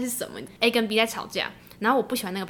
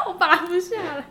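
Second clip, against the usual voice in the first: laughing; 7 semitones higher, slower than usual. Real, not acted. Laughing, picking at lips.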